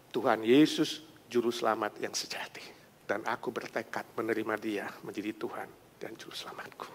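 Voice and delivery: husband whispering his new faith to wife; hushed confession. Acted. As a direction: confess it quietly.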